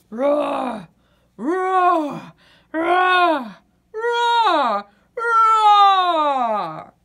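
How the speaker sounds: loud rising roars; monster roar. Acted. Monster in the dark woods roaring at Birdie, loud. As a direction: roar bigger each time like a beast.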